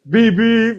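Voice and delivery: high, loud honk; punchy high-pitched honking noise; imitated car horn. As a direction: beep sharply like a car horn. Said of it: "He's, like, honking his horn".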